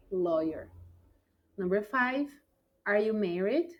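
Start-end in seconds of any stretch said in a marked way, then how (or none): 0.1-0.7 Lawyer said as loy-er, low and clear; modelled pronunciation. Shown as a demonstration. Teacher showing students how lawyer sounds, low and careful. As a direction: model Lawyer for the class, slow. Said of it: "não se parece nada com a escrita".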